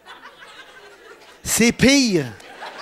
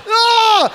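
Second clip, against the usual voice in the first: high yelp of cold; shocked cry; 10 semitones higher, 4 dB louder than usual. Retold or imitated. Icy shower again, a high yelp.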